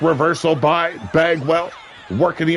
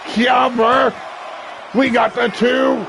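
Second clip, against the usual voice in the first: excited count; 8 semitones higher, slower than usual. Real, not acted. Near fall count.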